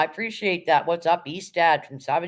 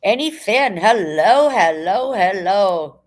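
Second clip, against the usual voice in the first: exuberant greeting; 3 semitones higher, 7 dB louder, much slower than usual. Real, not acted. Streamer welcoming a viewer into the chat, loud exuberant greeting. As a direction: call out a big welcome.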